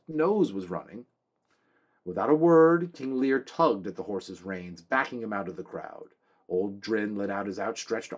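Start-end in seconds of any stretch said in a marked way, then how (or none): none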